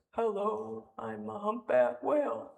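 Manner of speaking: slow, drawn-out whale-speak impression; Dory. Leader quoting Finding Nemo to the congregation, playful whale-speak. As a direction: stretch the words out like whale talk. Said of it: "That's how I, the Dory voice".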